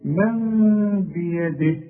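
من بيده with a long nasal meem hum, slow; iqlab ghunna. Shown as a demonstration. Teacher recites example for class, slow ghunna. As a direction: drag out the ghunna on من. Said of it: "نسمع تاني".

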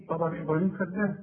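normal talking voice

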